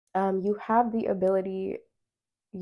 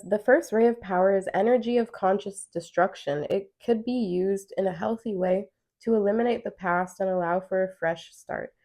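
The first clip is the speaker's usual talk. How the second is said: louder card reading voice; reading aloud; 6 dB louder than usual. Acted. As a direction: read the card text out clearly. Reads card text aloud.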